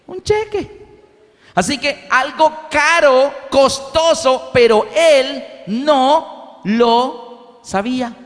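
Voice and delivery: high, slow emphatic reveal; emphatic punchline. Real, not acted. Preacher reveals the paper was a cheque, emphatic. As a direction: land the punchline with weight.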